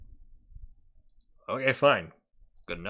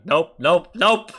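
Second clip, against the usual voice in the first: loud rising nopes, each one higher; emphatic nope; 6 semitones higher, 8 dB louder, slower than usual. Real, not acted. To the clip she dislikes, loud emphatic nopes. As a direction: reject it outright, getting louder and higher.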